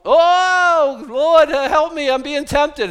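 loud, high-pitched cry for help; mock desperate plea. Preacher acts out praying to God when tempted, mock desperate plea. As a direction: wail it out, hammed-up, as a prayer under attack.

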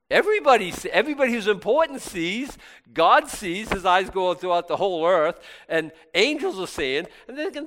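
loud, high preaching build. Acted. Preacher rousing the congregation, loud and high. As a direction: preach it out with full force.

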